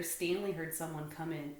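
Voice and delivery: ordinary delivery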